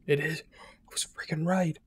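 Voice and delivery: slow amazed whisper. Real, not acted. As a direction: whisper it in disbelief. Triumphant realisation.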